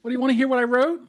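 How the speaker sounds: high, whiny offer; mock hurt. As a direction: ask it in a put-on wail. Mock squabble in high voices.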